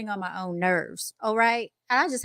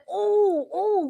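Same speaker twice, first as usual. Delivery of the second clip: friend hyped ooh; 11 semitones higher than usual. Retold or imitated. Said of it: "He was like".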